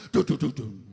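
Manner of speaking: loud mock drumroll of duns; suspense sting. Comic fills the wait for the vote result, mock suspense sting. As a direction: imitate the show's dramatic suspense music.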